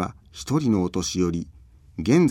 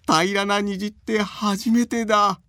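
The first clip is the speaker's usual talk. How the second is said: put-on high, slow gleeful old man; delighted old man; 11 semitones higher, slower than usual. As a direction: marvel like a tickled grandpa. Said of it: "大喜びのおじいさんの声".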